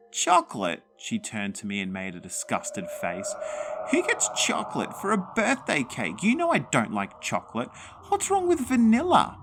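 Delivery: high, eerie complaining granny; a ghost sniffs at the cake; high, picky and scornful; fussy ghost granny. Acted.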